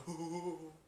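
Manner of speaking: soft mock stoner chuckle; Seth Rogen weed laugh. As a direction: imitate a stoner's laugh, quietly. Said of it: "that Seth Rogen weed sound".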